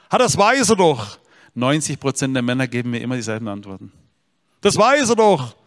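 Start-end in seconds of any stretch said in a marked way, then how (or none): 0.1-1.2 high, loud scoffing retort; father scoffing at the pastor's question; dismissive father. Retold or imitated. 4.6-5.5 high, loud scoffing retort; father scoffing at the pastor's question; dismissive father. Retold or imitated. Typical men's answer.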